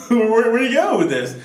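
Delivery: ordinary talk, nothing marked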